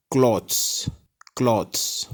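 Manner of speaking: क्लॉथ्स said "klawts", open o and ts ending; correct pronunciation. Shown as a demonstration. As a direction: say क्लॉथ्स with a clear final "ts". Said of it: "जो इसका सही तरीका है".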